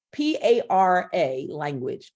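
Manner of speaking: "P A R A language" with each letter said apart, then the word; spelled out. Shown as a demonstration. Coach spelling a term for the class, letter by letter. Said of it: "all one word".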